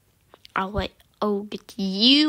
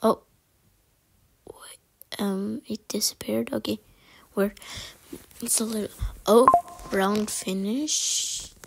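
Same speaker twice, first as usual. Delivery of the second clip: ordinary talk, nothing marked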